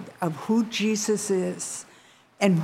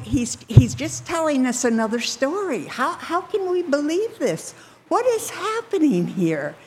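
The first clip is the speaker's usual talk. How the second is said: bewildered; 8 semitones higher than usual. Retold or imitated. Disciples puzzle over Jesus's prediction.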